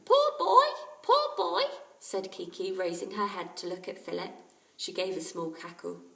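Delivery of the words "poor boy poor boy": high, loud squawking parrot. Acted. Parrot Kiki speaks.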